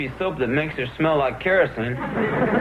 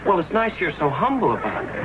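usual speaking voice

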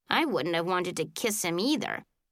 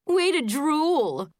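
slow, loud, high sneer; taunting; 6 semitones higher, 4 dB louder, much slower than usual. A narrator's reading. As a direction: drag out the mockery. Mean girl taunt.

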